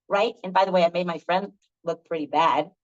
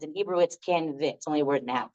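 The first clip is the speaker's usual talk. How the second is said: ordinary talk, nothing marked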